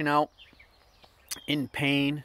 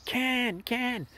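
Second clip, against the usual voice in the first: high calling after a truck; retold shout for Ken; 12 semitones higher than usual. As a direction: call his name from far off. Calling out from road.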